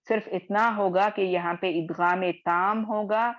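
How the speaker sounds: ordinary talk, nothing marked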